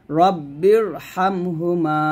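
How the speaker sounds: রব্বির drawn out slowly with a thick, heavy R; heavy R in recitation. Shown as a demonstration. Teacher to students, modelling a heavy R in রব্বির. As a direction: linger on রব্বির and make the R full. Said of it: "এরকম".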